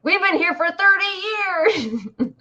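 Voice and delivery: high, drawn-out Northern accent impression; slow, high-pitched mock Northern accent; parents' Northern accent. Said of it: "my parents still have their Northern accent".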